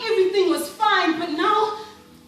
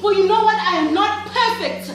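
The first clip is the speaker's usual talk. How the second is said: loud, exasperated outburst; defiant confession; 4 semitones higher, 6 dB louder than usual. Acted. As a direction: throw it out loudly, fed up.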